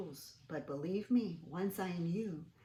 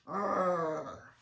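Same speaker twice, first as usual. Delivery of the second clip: loud gruff arr; caveman growl; 6 dB louder than usual. Acted. Cavemen grumbling at Stanley, growling. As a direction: grunt like a caveman.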